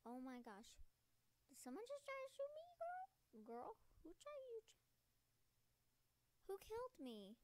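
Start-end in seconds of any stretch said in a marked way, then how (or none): 1.5-7.3 high, loud, outraged squeal; high-pitched indignation. Real, not acted. Gamer reacting to being shot at, high indignant outburst.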